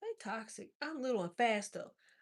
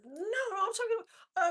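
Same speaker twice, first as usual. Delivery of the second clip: much higher, imitating a schoolmate; mock girl's voice; 11 semitones higher, 4 dB louder than usual. Retelling the schoolgirl's reply, in a higher mock voice. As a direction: squeak it up as the pleading girl. Voicing the girl again.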